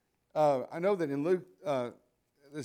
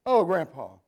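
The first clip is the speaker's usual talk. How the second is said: put-on much louder teen groan "Oh, Grandpa"; exasperated teen; 11 dB louder, slower than usual. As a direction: roll your eyes as a teenage granddaughter.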